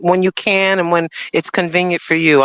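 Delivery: ordinary talk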